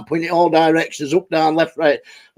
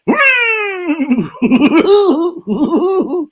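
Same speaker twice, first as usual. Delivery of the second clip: high cackling laugh; 9 semitones higher than usual. Real, not acted. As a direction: burst into cackling. Big laugh.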